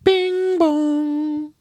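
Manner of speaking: high, ringing sing-song chime; drawn-out high "ding-dong" tone; doorbell imitation. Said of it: "I just hear".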